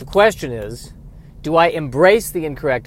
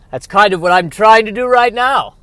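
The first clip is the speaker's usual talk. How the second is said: higher and louder hammed-up podcast voice; put-on voice; 6 semitones higher, 9 dB louder than usual. Podcaster driving past a bus sign, in a big put-on voice. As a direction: push it up into a showy performer voice. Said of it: "I don't know why I'm speaking in that voice".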